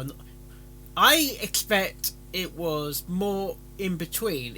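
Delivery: ordinary delivery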